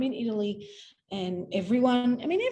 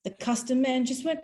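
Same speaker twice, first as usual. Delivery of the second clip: usual speaking voice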